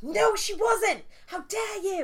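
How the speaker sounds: high, loud, hammed-up indignation; mock outraged fan. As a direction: play an offended superfan. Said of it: "someone is gonna be like".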